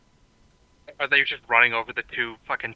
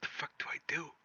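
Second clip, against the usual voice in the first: small, much softer, slightly higher; at a loss; 4 semitones higher, 15 dB quieter than usual. Acted. Tongue-tied leader facing the crowd, quiet and at a loss. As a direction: say it softly, lost for orders. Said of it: "he's like".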